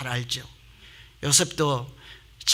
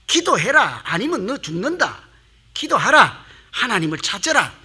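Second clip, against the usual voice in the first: raised, urgent divine summons; calling out loudly; 4 semitones higher, 4 dB louder than usual. Acted. God calling to a distant believer through suffering, calling out loudly. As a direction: call to someone far off, high and loud. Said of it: "큰 소리로 부르시는 거예요".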